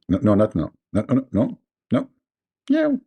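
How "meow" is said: high mock meow like a cat. Comedian to his audience, playing the cat, a high mock meow. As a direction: meow it like an angry little cat.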